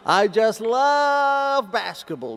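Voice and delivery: high, drawn-out LOVE; gushing emphasis. Real, not acted. Speaker telling the audience of a childhood passion, gushing. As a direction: stretch "love" out long and high.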